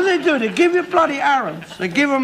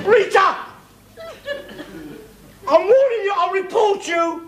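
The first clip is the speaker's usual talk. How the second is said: angry threat; 10 semitones higher than usual. Acted. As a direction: yell the warning at her. Father threatens daughter.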